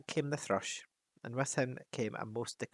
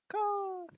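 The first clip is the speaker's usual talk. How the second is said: high croaking call; a short bird cry; raven croak. Acted. Raven punctuates his speech with a high croak.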